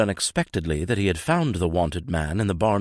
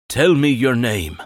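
loud, higher exorcist's demand; commanding demand; 5 semitones higher, 7 dB louder than usual. A narrator's reading. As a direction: insist with full force. Priest demands the name.

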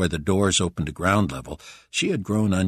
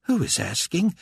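higher, rising impatient question; sharper demand; 11 semitones higher than usual. A narrator's reading. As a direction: ask again, impatient. Carpathia repeats angrily.

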